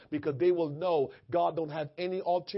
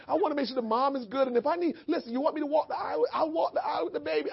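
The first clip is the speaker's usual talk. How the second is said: eager helper; 9 semitones higher, faster than usual. Retold or imitated. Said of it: "I'm all sensitive now".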